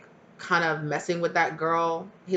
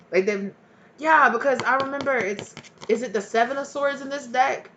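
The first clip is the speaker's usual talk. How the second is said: ordinary talk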